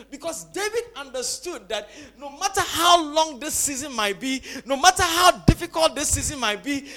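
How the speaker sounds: loud, soaring sermon climax; preaching at full force. Acted. Pastor rallying the congregation, a loud soaring climax. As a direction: lift the church with it.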